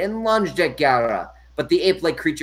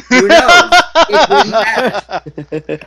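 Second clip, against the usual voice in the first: dramatic growly aside; 8 semitones higher, 11 dB louder, much slower than usual. Acted.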